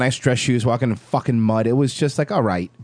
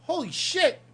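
high, awed dad aside; pitched-up mock amazement as dad; astonished dad; 14 semitones higher, 8 dB quieter, slower than usual. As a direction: imitate dad gawking under his breath. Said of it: "and he goes".

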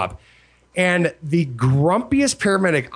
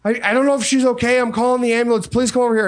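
urgent plea; 7 semitones higher, faster than usual. Retold or imitated. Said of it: "She's like".

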